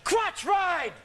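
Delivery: high, strained mock coach cry; coach yelling. Wrestling coach yelling at the match, high mock yell. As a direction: call out the move like a frantic coach. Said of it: "my coach would be yelling".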